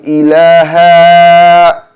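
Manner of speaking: ilaha with the final ha drawn out slow and loud; wrong elongated ha. Shown as a demonstration. Teacher mimics the mistake of lengthening ilaha, slow. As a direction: stretch the end of ilaha too long. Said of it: "it changes the meaning".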